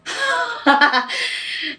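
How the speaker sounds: high cracking laugh breaking into the story; burst of laughter. Real, not acted. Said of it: "别他妈笑了".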